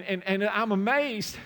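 ordinary delivery

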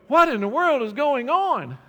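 high, loud bewildered wail of the frustrated; exasperated cry. Retold or imitated. Frustrated people crying out, loud and bewildered. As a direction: throw up your hands and cry out in disbelief. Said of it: "and they go".

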